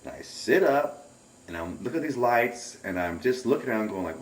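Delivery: ordinary delivery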